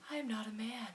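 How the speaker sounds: normal talking voice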